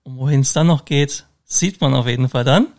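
ordinary talk

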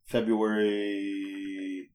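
hugely drawn-out word while searching memory; stalling for recall. Real, not acted. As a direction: hold the final vowel very long.

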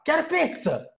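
put-on high-pitched drunken demand; demanding cry. S0 retells demanding pizza at the party, demanding cry.

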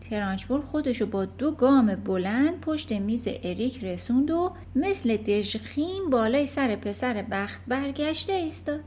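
plain reading voice

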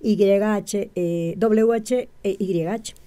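"Y H, eh, W H e Y H" spelled letter by letter, slowly; spelling out letters. Shown as a demonstration. Spelling God's name letter by letter for the interviewer, slow and deliberate. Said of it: "el nombre correcto de, de Dios es".

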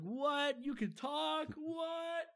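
high, startled squeak of disbelief; rising shocked cry on the last word. Retold or imitated. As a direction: jump way up in pitch, stunned the plant speaks. Seymour shocked by talking plant.

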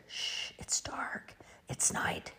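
hushed, very soft storybook whisper; shush, then whisper it slowly. A narrator's reading. Peep inside the blanket tent with Ducky, hushed whisper. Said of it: "Peep whispered".

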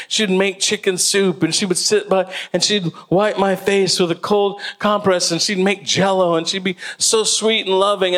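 normal talking voice

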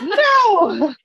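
loud high laughing cry of no; hammed-up shocked no; mock outraged no. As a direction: yell it in fake outrage, laughing. Mock outcry at show, laughing.

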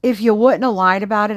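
normal talking voice

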